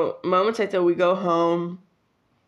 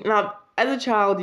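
usual speaking voice

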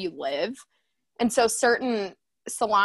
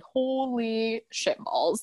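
awed; much slower than usual. Retold or imitated. Awed reaction to salon Instagram.

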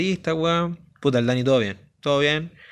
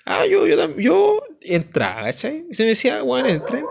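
much higher, mocking imitation of a friend; 12 semitones higher than usual. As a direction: parody him in a much higher voice. Mocking friend's earlier claim.

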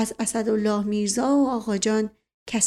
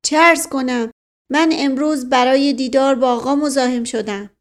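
put-on Persian in an Indian accent, raised and louder; 3 semitones higher, 5 dB louder than usual. A narrator's reading. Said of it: "با لهجهٔ هندی ولی به فارسی". (با لهجهٔ هندی)

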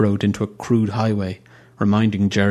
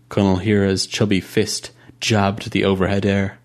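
plain reading voice

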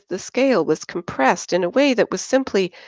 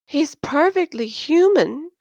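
much higher, pouting insistence; insisting; 10 semitones higher than usual. Acted. Christine defends her boyfriend to the table, pouting. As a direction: insist with a pout. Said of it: "Christine insisted".

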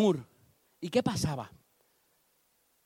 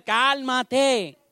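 firm calming; 5 semitones higher, 4 dB louder than usual. Acted. David calms Abisai.

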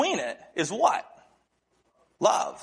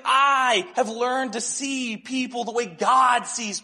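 slow, higher-pitched, emphatic declaration; retold earnest testimony; 6 semitones higher, slower than usual. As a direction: testify to a friend, stretching the key words. Retold earnest testimony to his friend.

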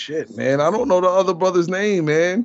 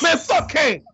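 high, yelled quote line; shouted movie quote; 12 semitones higher, slower than usual. Retold or imitated. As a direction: yell it like the movie.

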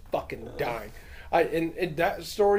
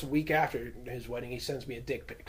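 ordinary talk, nothing marked

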